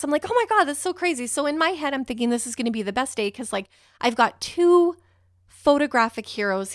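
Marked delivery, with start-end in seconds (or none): 0.3-1.3 excited. Retold or imitated. Excited reply to photographer, retold.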